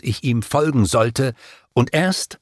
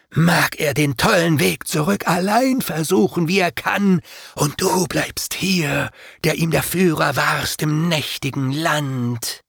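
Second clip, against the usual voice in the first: demons' taunt; 6 semitones higher than usual. A narrator's reading. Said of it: "meinten sie".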